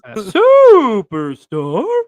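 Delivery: slow announcer drawl; wrestling introduction; ring announcer. Acted. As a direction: announce him like at ringside. Echo of nickname.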